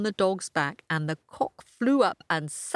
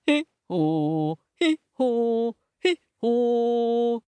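braying hee-haw; donkey bray; 4 semitones higher, 3 dB louder, much slower than usual. A narrator's reading. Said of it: "And the donkey brayed".

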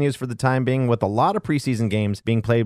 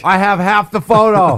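put-on loud, higher excited kid; excited kid brag; 4 semitones higher, 5 dB louder than usual.

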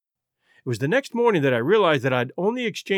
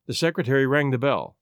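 plain reading voice

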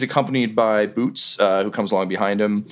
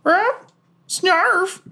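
very high parrot squawk. Acted. Parrot copying Goblin Junior's salute, parrot voice. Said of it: "The parrot's like".

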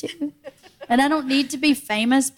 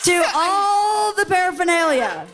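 shouted punchline; 6 semitones higher than usual. Retold or imitated. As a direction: belt out the punchline.

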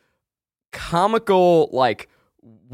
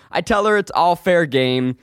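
ordinary delivery

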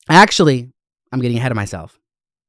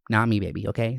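ordinary talk, nothing marked

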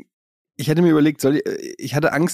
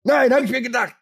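taunting; 10 semitones higher than usual. Pretend. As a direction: taunt a small child. Taunting the small child.

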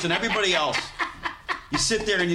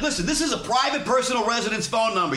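high, officious protest; indignant telling-off; 9 semitones higher than usual. Retold or imitated. Prank victim warning caller off his home phone, indignant telling-off. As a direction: imitate a man laying down the law.

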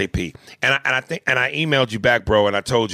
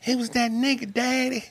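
put-on girl voice. Girl voice answering her daddy.

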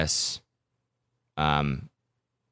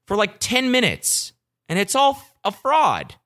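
rising outrage; 12 semitones higher, 3 dB louder, slower than usual. Real, not acted. Telling viewers he was duped, high rising outrage. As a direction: pitch climbs high on a fraud. Ranting about being fooled.

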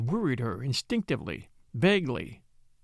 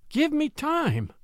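slow, much higher plea; imploring; 11 semitones higher, much slower than usual. A narrator's reading. Widow begging the blackmailer for time, imploring. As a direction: beg him for time, drawn out. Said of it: "she implored".

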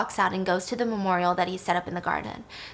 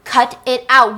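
angry snap; 3 semitones higher, 9 dB louder, slower than usual. Retold or imitated. Stan snaps at Lilith.

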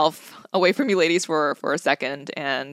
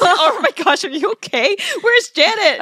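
high, loud mock-frantic questions; alarmed concern; 8 dB louder than usual. Friend phoning a stranded traveler, alarmed. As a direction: fire off worried questions in an exaggerated panic.

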